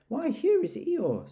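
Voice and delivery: Pooh, delighted; cheery bear. Acted. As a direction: greet a gloomy friend warmly. Pooh greets Eeyore.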